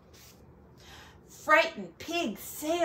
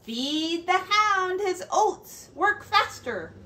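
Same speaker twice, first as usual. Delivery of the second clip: higher, louder bossy rooster giving orders; 8 semitones higher, 3 dB louder than usual. Acted. Rooster ordering the farmer around, bossy and high. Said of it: "his bossy rooster".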